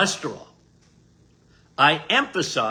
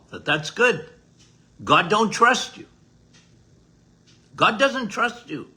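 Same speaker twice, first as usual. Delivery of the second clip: ordinary talk, nothing marked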